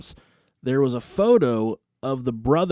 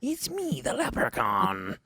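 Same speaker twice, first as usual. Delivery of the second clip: leprechaun voice; 6 dB quieter, slower than usual. Acted. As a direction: creep in as a tiny spooky leprechaun. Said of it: "a green leprechaun-like creature".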